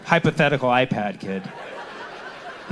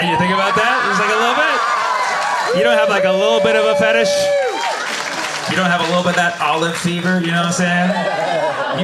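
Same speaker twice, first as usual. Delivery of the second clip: teasing loud accusation; 7 semitones higher, 3 dB louder than usual. Acted. Teasing the French audience.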